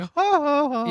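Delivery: high mock ha ha ha; mock kid laugh. Friend mocks a kid hunting raccoons, high mock laugh. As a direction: play a dumb kid laughing it off. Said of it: "just like".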